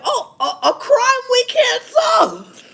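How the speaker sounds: high, slow, loud laughing squeal; shrieking with laughter in a mock voice; laughing mock cop. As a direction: cackle it out as the helpless cop. Mocking police, laughing through it.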